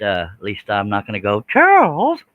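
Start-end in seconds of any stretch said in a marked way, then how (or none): 1.5-2.2 blurted name, much higher and louder; mock outburst calling a viewer's name; sudden yelp. Shown as a demonstration. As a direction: startle yourself by yelling the name. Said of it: "surprise myself that I said something".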